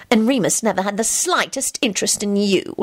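mildly spiteful jab; understated venom. A narrator's reading. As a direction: add it with spite. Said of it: "she added spitefully".

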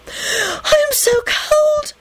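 high, slow pleading sob of a water nymph; beseeching cry. Acted. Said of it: "the girl cried out beseechingly".

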